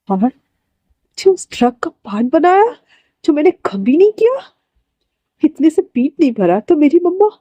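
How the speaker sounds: rising anger. A narrator's reading. Wing confronts Wangji in anger. (गुस्से में ऊँची होती आवाज़)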